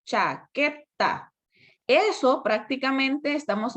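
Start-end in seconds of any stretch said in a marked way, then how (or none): 0.1-1.3 "chaqueta" split slowly into cha, que, ta; syllable by syllable. Shown as a demonstration. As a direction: give each syllable of "chaqueta" its own beat. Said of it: "pronuncio en un solo golpe de voz".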